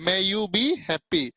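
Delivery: slow, gentle sample blessing; blessing example. Shown as a demonstration. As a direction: dictate the wish slowly for the class. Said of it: "देखो".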